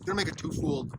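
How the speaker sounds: talking through a mouthful; words round a bite of food; mouth full. Real, not acted. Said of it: "saying twofold with a mouthful of food".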